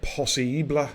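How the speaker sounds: put-on French-accented single word; casual slip into French pronunciation. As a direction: say it the French way, stressing the middle vowel. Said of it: "say that in French".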